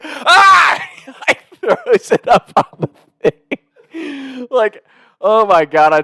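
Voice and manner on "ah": sudden high loud yelp from a hot pan; pained yelp. Retold or imitated. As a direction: yelp as if burnt by the tray. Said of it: "He's like".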